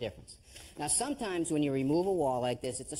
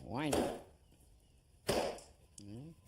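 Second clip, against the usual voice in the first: ordinary talk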